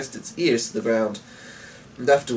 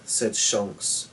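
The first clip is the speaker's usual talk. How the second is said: plain reading voice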